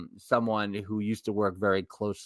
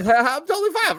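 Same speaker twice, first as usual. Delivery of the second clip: laughing; 9 dB louder than usual. Retold or imitated. Laughing nervous quote, high and loud.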